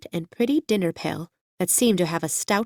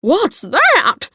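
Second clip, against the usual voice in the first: much higher, loud frightened squawk; terrified; 12 semitones higher, 7 dB louder than usual. A narrator's reading. Hen spots the strange creature, cries in terror. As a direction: shriek it out in sudden fright. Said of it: "in a voice of terror".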